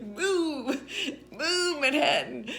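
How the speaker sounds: high, drawn-out mock yell; imitated screaming. Drunk who just kept at it, mock screaming. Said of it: "he's just screaming".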